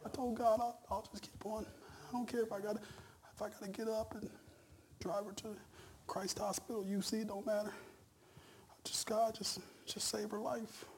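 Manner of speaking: mock tearful husband praying for wife; imitating dad crying. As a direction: voice the dad weeping on the phone, hushed. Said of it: "he's on the other end of the phone crying".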